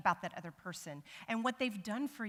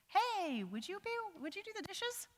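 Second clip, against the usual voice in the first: a high, sweet-voiced request, higher pitched; 12 semitones higher than usual. Shown as a demonstration. A partner sweetly asking for the dishes to be done. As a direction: ask sweetly and lightly, up high. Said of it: "someone has made a request".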